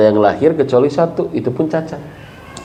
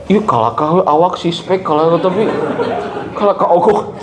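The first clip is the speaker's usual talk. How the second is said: mock wife complaining with a laugh; imitating the wife; 4 semitones higher than usual. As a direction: voice the wife's grumble lightly. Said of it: "Cek ibu teh".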